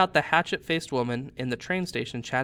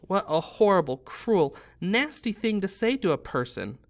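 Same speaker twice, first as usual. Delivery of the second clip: tearful; 6 semitones higher than usual. A narrator's reading.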